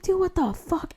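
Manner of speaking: high, soft disbelief; small high-pitched inner protest; incredulous aside. Retold or imitated. Applicant privately reacting to the manager, incredulous. Silent reaction to interviewer's answer.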